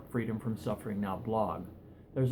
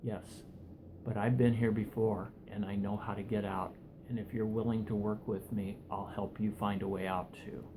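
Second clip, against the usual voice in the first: softer, low, steady and kind; calm reassurance; 3 semitones lower, 6 dB quieter than usual. Acted. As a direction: reassure him gently, like someone who's been there. Calm rescuer reassures him.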